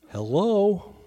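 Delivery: dry, pointed Hello?; sarcastic hello. Retold or imitated. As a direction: call out an incredulous Hello? as if to someone clueless. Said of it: "you can almost picture him going".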